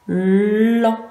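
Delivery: "lan" with a long held L before the vowel; drawn-out L. Shown as a demonstration. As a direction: stretch the L of "lan" so both sounds are heard. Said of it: "J'entends le L et j'entends le an".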